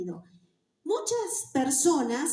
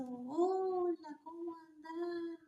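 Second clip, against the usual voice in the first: slow, soft, dragging greeting; softer and slow, a bored lazy speaker; lazy slow talk; 4 semitones higher, 8 dB quieter, much slower than usual. Shown as a demonstration. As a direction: drawl it out like you can't be bothered. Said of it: "la gente que habla lento".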